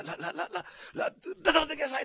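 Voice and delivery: high, stuttering, loud rush of a call; too hasty to wait, trip over the name then yell; an impatient Osaka man stammering the command out loud; impatient stammering shout. Shown as a demonstration. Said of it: "ってこう言いそうですね".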